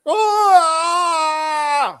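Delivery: a high, loud mock shriek; body snatcher scream. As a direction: shriek like the pod people film.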